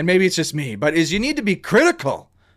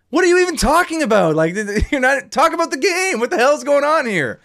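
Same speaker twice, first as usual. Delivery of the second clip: exasperated rant; 8 semitones higher than usual. Real, not acted. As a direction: vent at the broadcast, pitch climbing. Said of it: "Sorry, I'm ranting".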